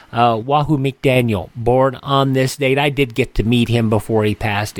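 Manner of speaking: ordinary delivery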